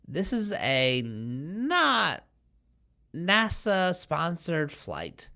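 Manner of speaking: "not" drawn out slowly and stressed. Acted. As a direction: lean hard on "not", then "nasa-sponsored flight" slow. Stressing it's not official.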